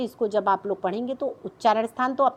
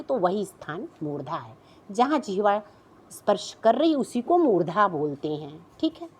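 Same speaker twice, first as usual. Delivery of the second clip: ordinary talk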